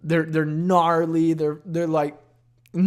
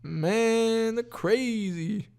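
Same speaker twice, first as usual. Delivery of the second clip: higher, slow dopey comic voice; mocking dumb comedian; 8 semitones higher, much slower than usual. Mocking hack comedians' lazy bits. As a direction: drag out the lame joke like a hack comic. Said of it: "Whoa, yelling".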